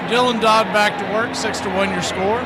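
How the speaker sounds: measured ballgame call between pitches; play-by-play call. Acted. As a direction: call each pitch to the radio audience.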